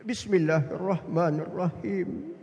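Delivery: slow, low, reverent recitation; unhurried melodic prayer; devout recitation. Retold or imitated. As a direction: imitate an absorbed worshipper, drawing out each word. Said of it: "Nangis dia".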